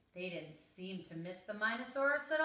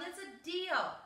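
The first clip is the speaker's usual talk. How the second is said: high-pitched delighted yell; 10 semitones higher than usual. A narrator's reading. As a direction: agree in a high, eager yell. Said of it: "The Minosaur yelled".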